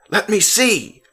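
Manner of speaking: shout, trying to get up; angry boy yelling at his father; yelled angrily. A narrator's reading. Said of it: "Alex yelled angrily".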